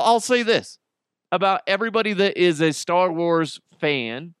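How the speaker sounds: ordinary talk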